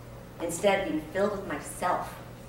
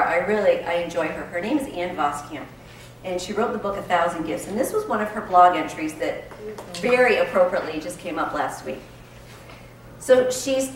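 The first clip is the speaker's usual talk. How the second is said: usual speaking voice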